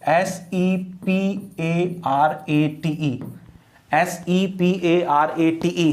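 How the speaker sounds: slow, separated letters; measured classroom spelling; spelled out slowly. Shown as a demonstration. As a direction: dictate it one letter at a time. Spelling out word for students.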